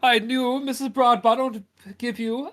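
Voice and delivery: beaming mayor welcoming visitors; jolly mayor. Acted. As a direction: fuss over the guests with glee. Mayor keeps gushing.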